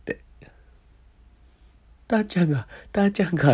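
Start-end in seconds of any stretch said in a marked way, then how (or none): 2.1-3.5 distraught. Retold or imitated. Disbelief at friend's accident.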